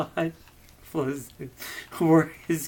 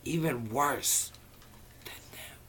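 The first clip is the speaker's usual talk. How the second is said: spooky whisper; 9 dB quieter than usual. A narrator's reading. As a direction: fade into a creepy hush. Spooky narration trailing to whisper.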